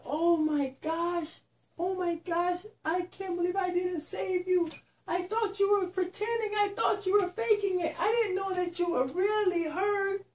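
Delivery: high, upset oh my gosh; shocked worry. Acted. Spider-Man shocked the bunny was really hurt, high and upset. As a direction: gasp it out, worried and sorry.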